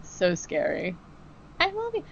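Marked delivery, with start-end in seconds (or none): 1.6-2.0 put-on much higher, soft childlike voice; a hint of a seven-year-old, high and breathy; little girl voice. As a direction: mimic a tiny girl on the spirit box. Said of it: "the voice of a seven-year-old".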